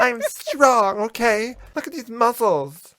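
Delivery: high, loud put-on strong woman.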